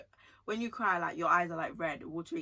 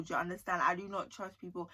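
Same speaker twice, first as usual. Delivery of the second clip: ordinary talk, nothing marked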